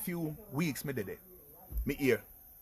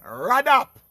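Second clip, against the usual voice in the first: retold judge; 10 semitones higher, 11 dB louder than usual. Voices the judge's sentence.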